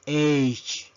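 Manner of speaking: letter H pronounced "eych"; English letter H. Shown as a demonstration. Teacher modelling the English alphabet, H as "eych". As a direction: say H as one-syllable "aitch". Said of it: "La letra H".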